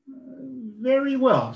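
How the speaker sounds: high, slow pig voice; little pig voice. Acted. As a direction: agree in a tiny high voice. Pig agrees.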